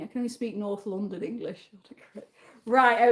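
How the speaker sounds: ordinary talk, nothing marked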